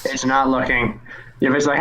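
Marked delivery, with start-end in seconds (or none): none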